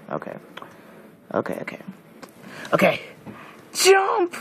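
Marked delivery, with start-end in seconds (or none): 3.7-4.4 high sudden cry; yelped command to jump; shrill shout. Real, not acted. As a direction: shout it like a panicked order. Yelling a command mid-game.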